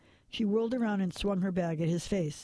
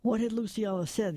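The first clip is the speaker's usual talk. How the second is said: ordinary delivery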